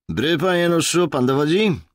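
loud, very high character voice; technician interrupting. A narrator's reading. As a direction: break in loudly on their conversation. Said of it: "przerwał im technik kryminalistyki". (głośne, wysokie wtrącenie technika)

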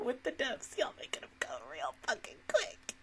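breathy whisper, voice dropped right down; whispered aside. Real, not acted. To viewers about the fast dubs, in a whisper. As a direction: hush it like a secret complaint.

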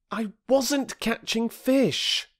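high, slow and earnest schoolboy; boy speaking the truth bravely. A narrator's reading. Peter answering the bargee honestly, high and slow. Boy's voice.

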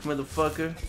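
ordinary talk

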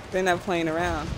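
ordinary delivery

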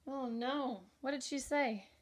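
usual speaking voice

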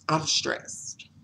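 breathy, barely voiced admission; whispered I'm stressed before another shot; whispered confession. Real, not acted. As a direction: let it out as a tired whisper. Tipsy admission after rant.

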